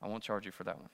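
very soft, low throwaway line; quiet aside. Real, not acted. Preacher closing a side message to the congregation, quiet aside. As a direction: drop it almost under your breath.

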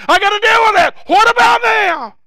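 rising high, loud outcry; building shout. Acted. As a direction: climb to a shouted question. Preacher building to a climax.

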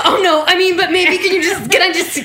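nervous dodging. Pretend. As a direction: stall for time, flustered. Nervous student dodging surgery.

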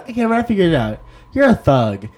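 mock his odd, earnest delivery; a light take-off of the nerdy coworker; imitated coworker. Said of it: "He said it like that".

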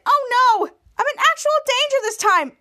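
high, loud panicked plea; mock panic. [name] tied up, begging the girls in high panic. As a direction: cry for help in a cartoonish way. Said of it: "[name] said".

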